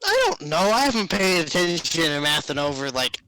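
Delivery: loud, high-swinging mock complaint; exaggerated loud excuse-making; hammed-up clueless student. Acted whiny answer to pop quiz.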